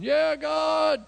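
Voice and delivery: high, loud triumphant cry to God; triumphant shout. Retold or imitated. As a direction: shout it out like calling fire from heaven. Preacher acts out Elijah calling fire.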